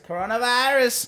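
high-pitched exclaimed catchphrase; hammed-up, sing-out delivery; catchphrase imitation. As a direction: cry it out high like a viral catchphrase. Mimics a catchphrase, high and stretched.

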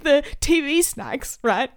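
high, slow, tearful explanation; mock crying. Crying caller explaining snacks to the vet, mock sobbing. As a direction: blubber it out in imitation of yourself. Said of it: "crying still".

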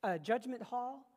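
loud, rising preacher's build; preaching at full force. Acted. Preacher to the congregation, building to a loud climax. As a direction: drive it home to the congregation.

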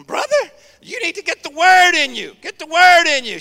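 put-on high, loud old Southern grandmother urging; old Southern church lady. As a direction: imitate a fervent elderly Southern woman exhorting. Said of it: "that's how she talked. She was from the South".

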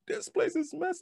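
high-pitched mock complaint; high, scandalized retold outcry; imitated dismay. Retelling wife's shock at dust.

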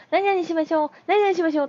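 high, repeated announcement mimicking a child; officious child imitation. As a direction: parrot the teacher like an eager kindergartner. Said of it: "みんなにわざわざそれを". (先生の言葉を触れ回る園児の高い声の物まね)

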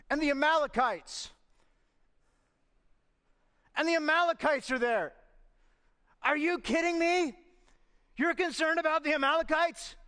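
high-pitched, incredulous preacher's outburst; indignant preaching. Real, not acted. Preacher scolding Israel for fearing Amalekites, indignant and incredulous.

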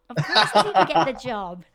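laughing through the words; bursting into laughter. Real, not acted. Laughing at own failed application.

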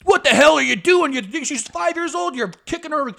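high-pitched indignant yelling; hammed-up outraged dad ranting; imitated angry parent. Girl's parent to boy who kicked her, mock yelling. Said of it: "he starts yelling at me".

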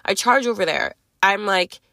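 normal talking voice

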